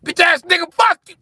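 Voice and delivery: very high, loud squealed insult; squeaky mock insult. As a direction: shriek it like a cartoon brat.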